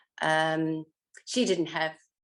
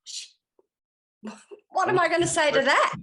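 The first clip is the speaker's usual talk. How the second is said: flustered inner thought; 10 semitones higher, 7 dB louder than usual. Retold or imitated. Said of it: "I'd be thinking to myself".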